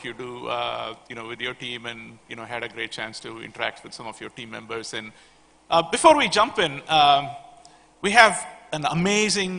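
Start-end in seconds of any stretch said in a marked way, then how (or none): 5.7-9.5 louder, higher hype for the panel; excited announcement. Real, not acted. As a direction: build up the crowd. Hypes the panel to the audience.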